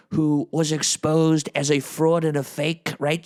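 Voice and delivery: ordinary talk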